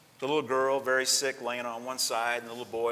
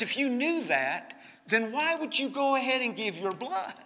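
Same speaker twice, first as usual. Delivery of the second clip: puzzled doctor; 10 semitones higher than usual. Retold or imitated. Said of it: "He says".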